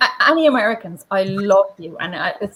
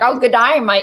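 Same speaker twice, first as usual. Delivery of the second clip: mock Aussie greeting, a bit louder; fake Australian accent; 2 semitones higher, 6 dB louder than usual. Back from an Australian summer, a mock Australian greeting. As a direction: ham up an Australian twang. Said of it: "suddenly they're like".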